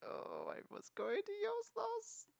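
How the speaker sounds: high, drawn-out mock lament; mock disappointment. Gamer lamenting lost PlayStation Star rewards, mock disappointed. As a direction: stretch it out slow and high, play-acting the letdown.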